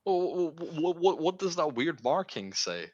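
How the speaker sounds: high, slow, stammering fear; stammering worry. Acted. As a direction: stutter it out, worried. Voicing a worried character.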